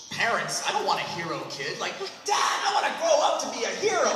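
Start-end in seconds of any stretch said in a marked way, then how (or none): 2.2-4.1 put-on higher, louder, laughing child voice; a hammed-up little kid, high and loud through a laugh; mock eager kid. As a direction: play a starry-eyed kid begging dad.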